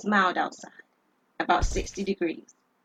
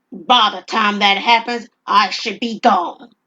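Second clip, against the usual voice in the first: loud, slow robber; 9 dB louder, slower than usual. Acted. Robber answers coldly.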